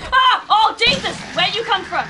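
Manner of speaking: high, loud cry of shock; startled yell. Real, not acted. Player yelling at an enemy that jumps out, startled. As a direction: jump and yell in sudden fright.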